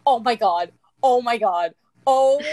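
loud thrilled oh my god; excited shouting. Real, not acted. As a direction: shout it out, delighted. Screaming over beloved character Belle.

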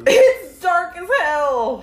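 slow, drawn out through laughter; laughing. Real, not acted. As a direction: laugh while protesting. Laughing protest.

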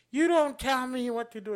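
indignant mother. Retold or imitated. Mom defying the security guy, high and indignant. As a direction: snap back like the defiant mom. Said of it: "she's like".

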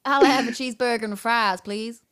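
put-on Southern US accent; Brit's very Southern American accent. Shown as a demonstration. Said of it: "That was very Southern".

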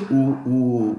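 coughing through the words; hoarse, coughing between words; coughing fit. Real, not acted. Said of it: "desculpa pelas tosses".

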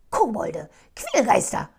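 loud alarmed cry; shout. Acted. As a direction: cry out in the night. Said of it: "rief er".

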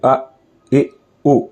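tiny, clipped अ, इ, उ with pauses between; brief, relaxed vowels अ, इ, उ; very short vowels. Shown as a demonstration. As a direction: cut अ, इ, उ as short as possible. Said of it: "छोटा बिल्कुल".

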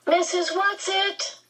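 high-pitched plea, calling out; despairing call. A narrator's reading. Captive Meg calls on Missus Whatsit for help, despairing. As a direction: call out desperately, voice pitched far up. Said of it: "Meg called despairingly".

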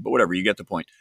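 ordinary talk, nothing marked

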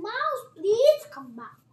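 high, loud pleading call; calling after someone. Acted. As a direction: call out loudly after him. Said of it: "she called after him".

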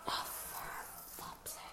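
whispered frustration. Real, not acted. Frustrated over the nails.

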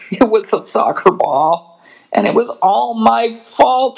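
high, slowing, pleading confession; confessing cub. Acted. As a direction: blurt out the truth, high. Brother confesses.

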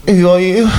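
high, loud put-on singer voice; squeaky mock rock-star voice. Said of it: "That's my impression of [name]".

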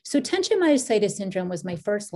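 ordinary talk

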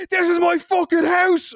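high, drawn-out indignant yell; mock furious outburst. Host to drunk party crasher, hammed-up fury. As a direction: imitate an outraged host losing it. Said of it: "He starts, like, losing his shit".

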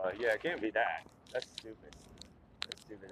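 usual speaking voice

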